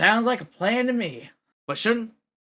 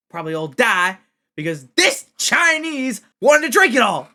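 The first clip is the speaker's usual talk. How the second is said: loud, high heated shouting; angry rant; 6 semitones higher, 6 dB louder than usual. Acted. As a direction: hammer the point home furiously. [name] ranting at the group.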